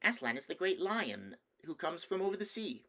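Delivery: put-on high child voice full of wonder; small boy proudly explaining; babbling little boy. A narrator's reading. Nephew telling the king about the great lion, babbling little boy. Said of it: "But he babbled on".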